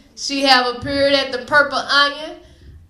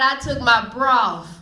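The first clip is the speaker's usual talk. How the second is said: ordinary talk, nothing marked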